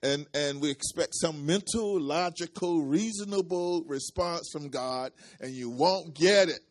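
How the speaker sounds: loud, emphatic sermon delivery; forceful preaching. Real, not acted. Preacher warns the congregation against expecting logical answers, preaching forcefully. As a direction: preach it hard, stretching the key words.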